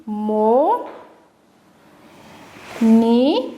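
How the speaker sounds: "mo, ni, ka" with long pauses between; each of "mo", "ni", "ka" standing alone; split into syllables. Shown as a demonstration. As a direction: dictate "mo, ni, ka" beat by beat. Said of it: "on a découpé syllabiquement les mots".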